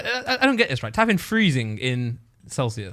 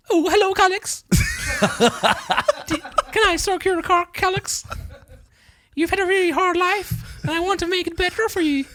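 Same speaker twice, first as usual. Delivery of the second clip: put-on a sing-song, much higher Swedish accent cooing at Calix; Swedish girl voice.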